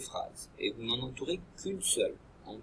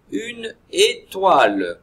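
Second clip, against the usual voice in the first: "Une étoile" said slow and louder; slow clear word; 7 semitones higher, 13 dB louder, much slower than usual. Shown as a demonstration. Teacher reading picture word to pupils, slow and loud. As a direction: stretch out "Une étoile" loudly for the class. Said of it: "Quelle est la syllabe".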